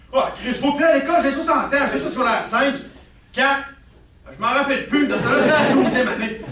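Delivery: loud, high acted rant; fast, exasperated comeback at the son; raging father. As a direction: rage back with the pitch up. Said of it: "pourquoi tu me rages".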